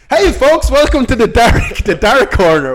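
teasing his friend, hammed-up TV host intro; mock show host. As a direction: announce it like a cheesy TV host.

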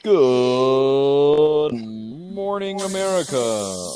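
slow, drawn-out TV host opener; morning show host. Acted. Host opening the podcast, drawn-out TV greeting. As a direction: stretch it like a breakfast TV greeting.

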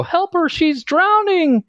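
very high, slow little voice; squeaky tiny doll voice. Retold or imitated. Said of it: "and go".

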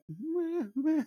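high little mock-baby bleats; imitated baby fussing. Dad imitating his baby son during the match, high fussy whimper. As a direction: mimic a baby starting to fuss, high-pitched. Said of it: "he's just starting to, like".

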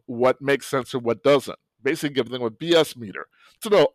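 normal talking voice

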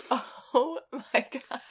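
laughing, stretched-out mock shock; mock gasp. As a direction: drag it out in laughing disbelief. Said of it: "I was like".

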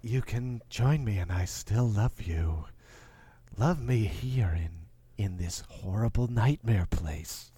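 low, slow, seductive ghost voice; ghostly dead wife. Retold or imitated. Podcaster voicing the dead wife inviting her husband, low eerie coaxing. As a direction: lure him in gently from beyond the grave. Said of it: "she's like".

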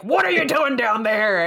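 a loud mock interrogation, but still half-playful; mock yelling. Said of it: "He's just yelling in his face".

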